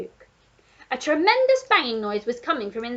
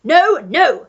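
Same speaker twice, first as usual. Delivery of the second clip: put-on loud old Duke protest; Duke shouting; 5 semitones higher, 14 dB louder than usual. A narrator's reading. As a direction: bark it urgently. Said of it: "shouted the Duke".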